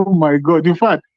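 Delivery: ordinary talk, nothing marked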